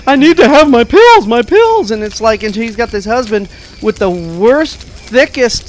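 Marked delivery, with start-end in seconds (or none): 0.1-1.9 high-pitched hammed-up panic, rising on the repeat; mock frantic. Imitating the film's crazy lady begging for pills, mock frantic. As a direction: play a melodramatic woman desperate for medication. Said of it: "she says that she's going crazy".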